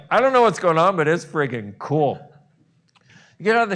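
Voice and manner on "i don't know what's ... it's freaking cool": thrilled. Pretend. You and body thrilled.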